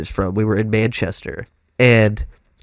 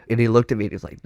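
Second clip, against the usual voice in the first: ordinary talk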